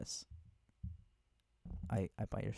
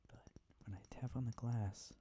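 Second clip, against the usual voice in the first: ordinary talk, nothing marked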